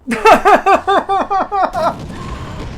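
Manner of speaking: high burst of laughter; loud laugh. Real, not acted. Bullet magnet day, big laugh.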